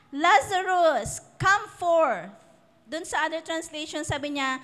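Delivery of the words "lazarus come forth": slow, raised call to the dead man; preacher voicing Jesus calling Lazarus out loud. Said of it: "he cried with a loud voice".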